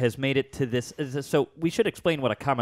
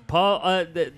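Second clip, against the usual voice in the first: ordinary delivery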